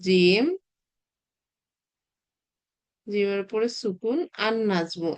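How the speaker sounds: জিম drawn out, then জিমের উপরে সুকুন আর নাজমু spelt slowly; slow spelling out. Shown as a demonstration. Teacher spelling নাজমু slowly for students. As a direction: read the word out mark by mark. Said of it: "কেন দেখেন তো এখানে হচ্ছে লিখছি কি".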